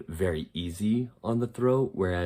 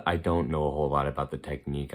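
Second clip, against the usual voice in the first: ordinary talk, nothing marked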